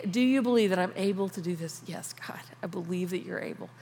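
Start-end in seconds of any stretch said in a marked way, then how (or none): 1.9-3.7 hushed, intimate whisper to God; whispered prayer. Acted. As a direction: breathe the words like a private prayer.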